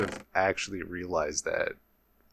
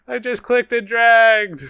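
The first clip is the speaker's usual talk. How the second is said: high, loud, drawn-out wail of exasperation; real outburst, stretched and high-pitched; exasperated yell; 12 semitones higher, 9 dB louder than usual. Real, not acted. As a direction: yell it in disbelief at your own blunder. Repeats it louder, upset at misclick.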